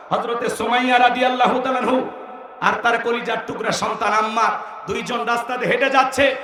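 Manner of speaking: ordinary talk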